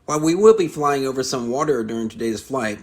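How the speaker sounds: polished cabin announcement voice; flight attendant. Acted. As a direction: read it like a safety briefing on board. Reads a cabin safety announcement.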